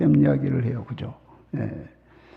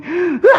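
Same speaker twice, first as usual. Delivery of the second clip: high shouted wail of torment; a sharp high cry of pain; anguished scream; 13 semitones higher, 5 dB louder, slower than usual. Retold or imitated. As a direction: let out a tormented howl. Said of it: "소리 지른대".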